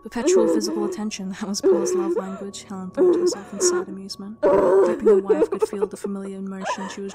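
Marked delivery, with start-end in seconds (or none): none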